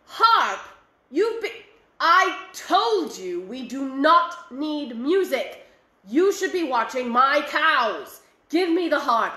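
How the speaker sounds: loud, stern father scolding; angry father. Acted. Father scolding his son over the harp, loud and stern. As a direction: snap at the boy and demand the harp.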